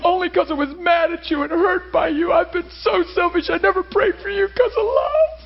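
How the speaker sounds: high, impassioned crying imitation; high-pitched, emotional retelling of a weeping wife; retold tearful confession. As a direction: pour out her confession as if through tears. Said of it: "gasping for breath and crying".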